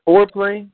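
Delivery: FOREPLAY punched high and loud; emphatic. Real, not acted. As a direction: hit Foreplay hard and high. Opening his answer on foreplay.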